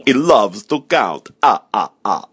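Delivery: slow, drawn-out puppet vampire who loves counting; theatrical slow delivery, then ah-ah-ah laugh; Count from Sesame Street. Acted. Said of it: "talking to the Count from Sesame Street".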